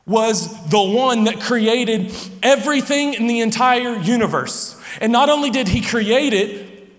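animated full-force preacher; fervent preaching. Real, not acted. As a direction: preach it with rising energy, a little laughing.

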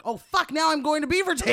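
high, loud panicked outburst; mock panic. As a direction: panic like a lost driver. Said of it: "its own little panic".

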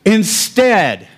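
"Instead" drawn out and pitched high; "Instead" announced with strong stress; emphasis on INSTEAD. Shown as a demonstration. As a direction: land hard on "Instead", then leave it hanging. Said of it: "here's where it goes".

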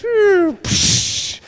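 high whoosh then unvoiced crash; mock whoosh and crash noise; whoosh and smash sound. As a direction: imitate the vase flying and shattering. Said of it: "the statue goes".

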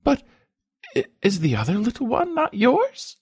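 high, halting, tearful question; asking through tears. A narrator's reading. Spoiled wife persisting about the other child, tearful. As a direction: stammer it high through tears, still insisting. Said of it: "asked through her tears".

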